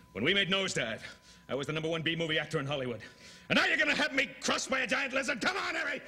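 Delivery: high, fast indignant rant; outraged pleading. Acted. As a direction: protest wildly to the agent. Actor outraged at the offer.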